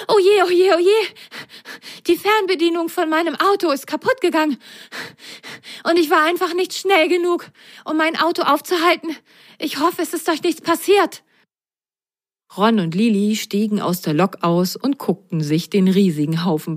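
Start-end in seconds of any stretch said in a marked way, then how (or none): 0.1-11.2 very high, breathless bunny voice; stuffed rabbit panting after his car, flustered; panting toy rabbit. A narrator's reading. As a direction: gasp it out in a fluster. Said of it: "Er japste".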